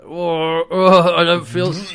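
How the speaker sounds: put-on about-to-vomit groan; hammed-up nausea, cut short; mock queasy. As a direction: imitate someone suddenly feeling sick. Acting Rigel about to vomit.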